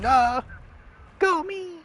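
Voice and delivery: high, loud victory yelp; excited cheer. Real, not acted. Gamer celebrating his own shot with a high yelp.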